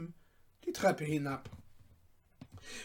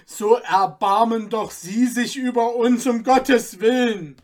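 louder begging voice; 4 semitones higher, 10 dB louder than usual. Acted. Cutler begging the merchant, imploring. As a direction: beg him once more.

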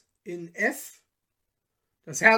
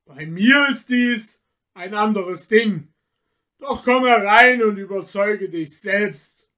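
louder, mocking forest spirit; scornful tempter; 8 dB louder than usual. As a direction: lecture him with contempt. Michel answers calmly, scornfully.

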